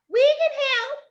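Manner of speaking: very high squealed offer; high girlish shout; girls yelling. A narrator's reading. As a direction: squeal it like eager little girls. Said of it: "the girls yelled out".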